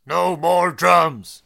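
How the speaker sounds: slow, loud growling muppet; Animal voice. Acted. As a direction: bellow it like Animal. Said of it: "How does he sound, Animal?".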